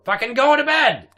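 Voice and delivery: loud, high scolding shout; angry scolding. Acted. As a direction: yell at the kids to go to bed. Scolding kids again.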